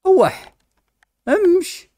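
high yelp then order; high-pitched shoo. Real, not acted. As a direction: squeak a high shoo at the animal. Shooing pet in game.

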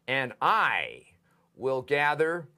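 "I" stretched out and punched, then a pause; stress on I. Shown as a demonstration. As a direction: lean hard and long on "I" before going on. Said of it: "that's for emphasis".

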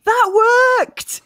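high-pitched mock shout; playful shout. Player has her character shout while fleeing, mock shout. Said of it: "and shout".

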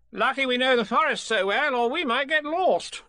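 high, loud, breezy Rabbit; cocksure Rabbit. A narrator's reading. Rabbit lost but boasting, cocksure high voice. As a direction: bluff confidently, high and loud.

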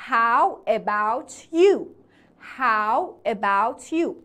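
"How about you?" slowly, the t of "about" running into "you"; linked t and y. Shown as a demonstration.

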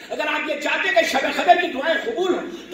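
usual speaking voice

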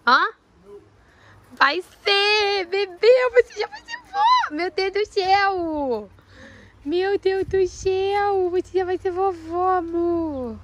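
high cooing to a pet. Real, not acted. Owner cooing to the pregnant mare.

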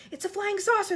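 high-pitched crowd cry; excited shout. A narrator's reading. Crowd spotting the peach overhead, excited shout. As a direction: call out in wild excitement. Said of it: "they shouted".

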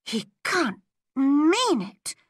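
high, slow disbelief; dismayed schoolgirl, pitched high; horrified thought. A narrator's reading. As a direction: think it aloud, aghast, dragging the words. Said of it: "she thought".

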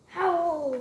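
a short playful howl; wolf howl. Acted. Child reads the wolf's line, mock howl. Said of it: "says the wolf".

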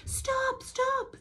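high urgent yell. A narrator's reading. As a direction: call out for him to stop. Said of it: "they yelled".

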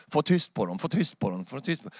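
soft repeated hushing, fading away; mock anxious hushing. Religious people running round to silence shouters, mock hushing. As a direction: play fussy hushing under your breath, trailing off.

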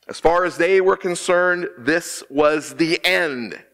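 usual speaking voice

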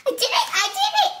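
proud cheer. Acted. Child reading the proud page as a gleeful cheer. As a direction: shout it to the crowd, beaming. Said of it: "a very funny voice for each tone".

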